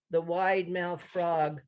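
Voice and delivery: normal talking voice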